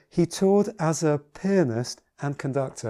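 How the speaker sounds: "pianist" brought out by slowing into it; emphasize "pianist", stretched and set apart; stress on pianist. Shown as a demonstration. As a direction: linger on the word "pianist". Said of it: "I emphasize the word pianist".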